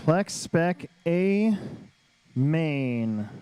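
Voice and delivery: very slow, stretched event name; drawn-out announcer call. Real, not acted. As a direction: draw out each word, then drop. Announcing the main event again.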